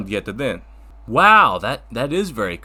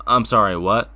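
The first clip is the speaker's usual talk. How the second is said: ordinary talk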